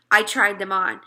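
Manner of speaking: usual speaking voice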